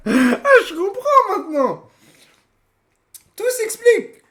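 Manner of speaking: high laughing aha, words bubbling through laughter; laughing realization. Real, not acted. Streamer cracks up realizing why the video is long, laughing. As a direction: laugh as it finally clicks.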